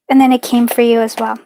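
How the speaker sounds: ordinary delivery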